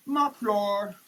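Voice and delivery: ordinary delivery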